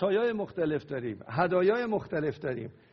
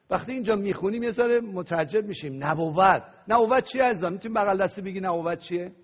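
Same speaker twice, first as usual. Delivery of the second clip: normal talking voice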